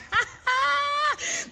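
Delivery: high, loud shout of a laugh; loud burst of laughter. Real, not acted. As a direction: let out a big whooping laugh. Reacts to viewer comment.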